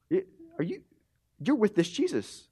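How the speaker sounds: put-on high, hesitant servant-girl voice; teenage servant girl. Servant girl questioning Peter in the courtyard, imitated high voice. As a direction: imitate a girl spotting someone, pitched well up. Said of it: "a servant girl, probably a teenager".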